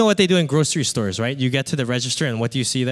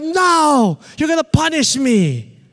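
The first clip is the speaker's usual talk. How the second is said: high, loud mock refusal; hammed-up childish panic; panicked child; 12 semitones higher, 4 dB louder than usual. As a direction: cry out like a kid barricaded in. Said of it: "I'm like".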